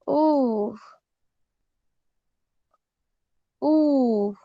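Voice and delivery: ऊ held long and even, falling at the end; long vowel. Shown as a demonstration. Teacher showing students the long ऊ, stretched out. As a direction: draw out the ऊ slowly for the class. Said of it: "लंबा खींचा जाता है".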